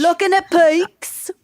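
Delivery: high, slow, hammed-up Brit; mock British accent. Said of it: "in a British accent".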